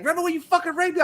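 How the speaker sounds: fast, high imitated accusation; mock yell. Imagining somebody confronting him, high mock yell. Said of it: "if somebody yelled at me".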